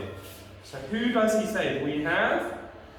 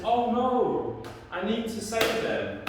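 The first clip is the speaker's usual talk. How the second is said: high, theatrical storybook dismay; alarmed hunter; 5 semitones higher than usual. Acted. Hunter sees who was eaten, high acted alarm. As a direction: raise the pitch in mock alarm. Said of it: "So the hunter says".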